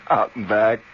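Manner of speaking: normal talking voice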